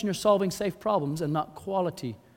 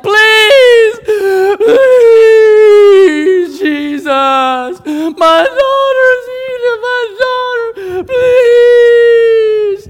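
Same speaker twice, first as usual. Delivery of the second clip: screamed, high, drawn-out wailing plea; acted hysterical begging; desperate pleading; 18 dB louder, much slower than usual. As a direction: wail and beg at the top of your voice. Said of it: "No, no, she's like".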